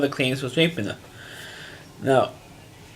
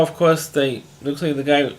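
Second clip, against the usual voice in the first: ordinary talk, nothing marked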